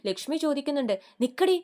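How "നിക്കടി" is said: sharp, loud stop command, pitch up; angry order. A narrator's reading. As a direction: stop her in her tracks, loud and high. Mother stops daughter angrily. (ദേഷ്യത്തോടെ ഉറക്കെ)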